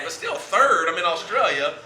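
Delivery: loud, high-pitched comic protest; hammed-up offended outburst; mock indignation. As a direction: protest it loudly as a wounded star. Retold protest at being ranked third.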